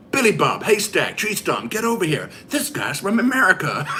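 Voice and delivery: loud, high hammed-up American accent. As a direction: holler to your buddies in a mock American accent. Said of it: "How's my American accent?".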